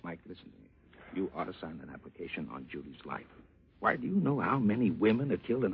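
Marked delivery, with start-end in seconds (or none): 0.0-3.2 hushed. Acted. Confidential insurance pitch to Mike.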